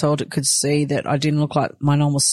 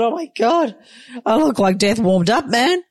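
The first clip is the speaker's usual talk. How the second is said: mock horror; 5 semitones higher than usual. Recalling looking terrible.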